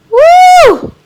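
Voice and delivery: high, loud whoop of joy; excited whoop. Real, not acted. Vlogger cheering the eyeshadow, loud excited woo. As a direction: let out a thrilled woo. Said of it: "getting a little excited".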